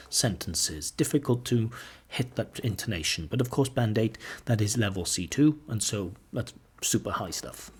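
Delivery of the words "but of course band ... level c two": usual speaking voice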